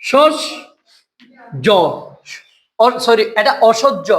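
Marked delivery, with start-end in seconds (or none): 0.1-1.7 "সস", long pause, then "জ"; sounded out in parts. Shown as a demonstration. Teacher breaking a word for students, "সস" and "জ" apart. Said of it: "স্বর এখানে কি উচ্চারণ হয়েছে".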